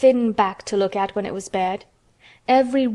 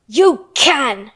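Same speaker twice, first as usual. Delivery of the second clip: loud angry contradiction; shouted; 3 semitones higher, 7 dB louder than usual. A narrator's reading. Angry girl overrules the sobbing boy, shouting. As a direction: fire it back forcefully. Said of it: "shouted Mary".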